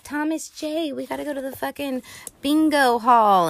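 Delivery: put-on high, bossy bratty kid voice; bratty girl. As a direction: boss him around like a spoiled brat. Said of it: "total little fucking biatch".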